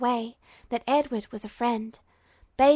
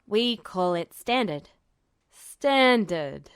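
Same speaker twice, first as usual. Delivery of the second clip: lightly voiced general; 4 semitones lower, slower than usual. General naming the language.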